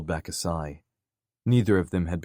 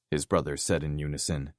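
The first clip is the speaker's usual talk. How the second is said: usual speaking voice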